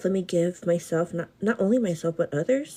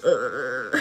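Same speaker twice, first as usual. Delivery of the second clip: disgusted groan; 7 semitones lower, 4 dB louder than usual. Retold or imitated. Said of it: "I'm like".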